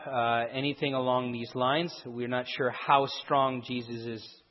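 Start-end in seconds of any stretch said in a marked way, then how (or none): none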